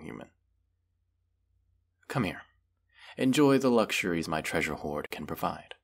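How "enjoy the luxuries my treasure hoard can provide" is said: normal talking voice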